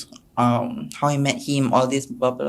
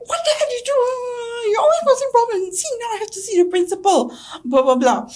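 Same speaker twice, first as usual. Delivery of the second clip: very high, wailing mock scold; mock crying parent; 4 dB louder than usual. Parent shrieks at daughter over school, mock wailing. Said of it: "It's like".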